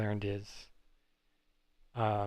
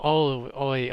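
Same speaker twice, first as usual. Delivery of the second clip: sudden surprise; 2 semitones higher, 8 dB louder, slower than usual. Real, not acted. Spots a tremolo on the guitar.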